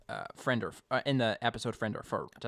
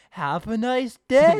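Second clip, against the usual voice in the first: slow, loud, much higher hammed-up shopkeeper voice; Bart; 12 semitones higher, 13 dB louder, slower than usual. Imagining the shopkeeper waving Connie off, loud, high and slow. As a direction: ham up a pushy salesman's farewell, slow and loud. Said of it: "can you imagine if this was Bart".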